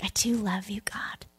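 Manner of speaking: soft, whispered I do love you; barely voiced, moved; whispered confession. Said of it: "Peter's like".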